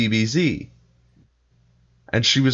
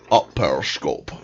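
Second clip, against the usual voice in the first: Sean Connery impression; 8 semitones lower than usual. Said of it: "Sean Connery accent".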